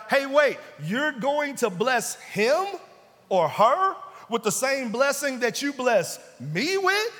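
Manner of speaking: loud, high, outraged protest; mock believer loudly protesting to God; indignant complaint. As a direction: throw the unfairness back at God.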